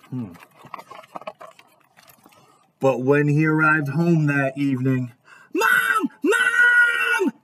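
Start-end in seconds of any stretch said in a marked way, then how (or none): 5.5-7.3 high-pitched frightened scream; scared kid shrieking for help; screaming for mom. A narrator's reading. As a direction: yell for mom in a panic, stretching the word. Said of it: "Jasper screamed".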